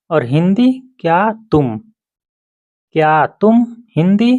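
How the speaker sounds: ordinary talk, nothing marked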